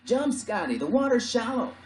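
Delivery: high, loud yelled order; sailor's yell. Acted. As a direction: call out to a shipmate overboard. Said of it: "Someone yelled".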